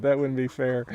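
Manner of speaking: ordinary delivery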